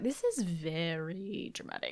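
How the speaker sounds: slow deadpan sarcasm; drawn-out unimpressed remark; dry sarcastic. Retold or imitated. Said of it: "I was like".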